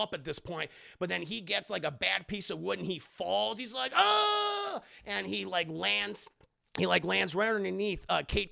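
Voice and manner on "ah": falling scream. Retold or imitated. Villain falls.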